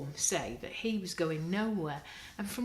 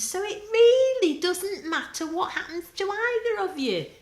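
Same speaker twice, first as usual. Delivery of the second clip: very high, loud shrill scolding; shrill fairy; 12 semitones higher, 14 dB louder than usual. A narrator's reading. Porcelain fairy ordering Spider and Marcia away, high and shrill. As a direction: shriek them off the tree. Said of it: "in a shrill little voice".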